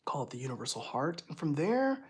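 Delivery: calm guided imagery; guided visualization. Acted. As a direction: lead them up into the stars softly.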